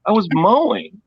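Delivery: high, indignant reply; indignant retort. Retold or imitated. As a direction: answer high and put out. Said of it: "I'm like".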